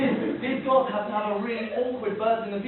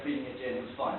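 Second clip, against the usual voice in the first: ordinary talk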